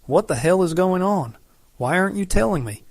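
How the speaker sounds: angry questions tumbling out quickly; furious. Acted. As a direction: demand answers in a fast rage. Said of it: "Suddenly I'm freaking furious".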